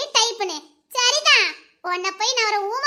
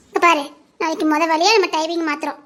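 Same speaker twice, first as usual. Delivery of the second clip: usual speaking voice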